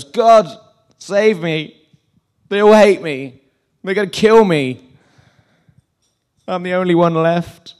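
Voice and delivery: loud, high-pitched wail to God; hammed-up self-pitying plea; mock whining. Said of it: "and whines".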